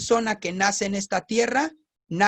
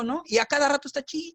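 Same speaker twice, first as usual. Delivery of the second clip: normal talking voice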